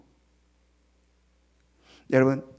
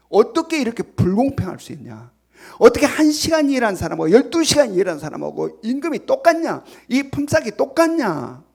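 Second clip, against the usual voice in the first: acted indignant complaint, building higher and louder; angry protest; 5 semitones higher, 6 dB louder than usual. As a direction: protest the unfairness as an aggrieved worker. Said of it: "막 항의를 한 겁니다". (분노해서 항의하듯)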